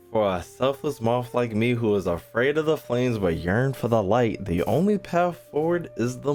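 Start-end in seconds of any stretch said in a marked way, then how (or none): none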